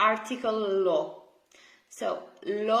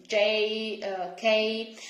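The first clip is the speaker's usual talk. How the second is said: ordinary talk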